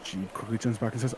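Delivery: ordinary delivery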